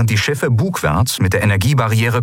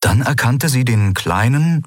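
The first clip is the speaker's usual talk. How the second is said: plain reading voice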